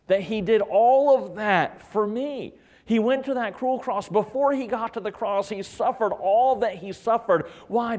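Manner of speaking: high, pressing sermon voice; impassioned preaching. Real, not acted. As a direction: preach it with mounting insistence. Preaches on the cross.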